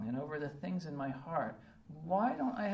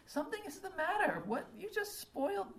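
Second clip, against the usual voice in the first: much higher, fast and exasperated, a put-upon inner voice; 14 semitones higher than usual. Retold or imitated. Driver spotting roadkill on a perfect morning, high exasperated outburst. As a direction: blurt it out like your perfect morning just got ruined.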